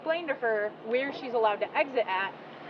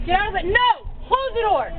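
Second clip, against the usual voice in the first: angry shouting; 11 semitones higher than usual. Real, not acted. Officer finds girl in cruiser.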